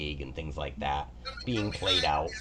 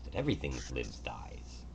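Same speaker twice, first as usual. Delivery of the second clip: whispered, softer line on death's law; grim whisper; 6 semitones lower, 6 dB quieter than usual. Acted. The god whispers the law of death to the player. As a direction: drop to a hush for the dark truth.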